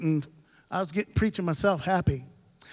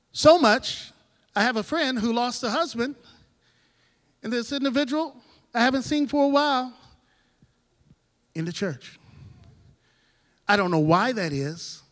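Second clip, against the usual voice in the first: usual speaking voice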